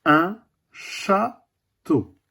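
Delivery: château drawn out very slowly; slow teaching word. Shown as a demonstration. A teacher showing the class château, very slowly. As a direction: stretch un château slowly for the children. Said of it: "Quatrième dessin".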